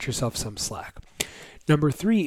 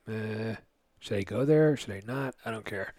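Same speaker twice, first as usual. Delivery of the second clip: can't-be-bothered parent; 3 semitones lower, 7 dB quieter than usual. Retold or imitated. Seasoned parent shrugging off crying.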